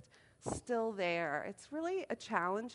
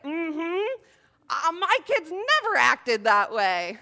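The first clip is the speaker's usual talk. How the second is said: louder, slow, smug old-man imitation; 6 semitones higher, 9 dB louder, slower than usual. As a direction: drag it out like a know-it-all grandpa. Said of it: "And he's like".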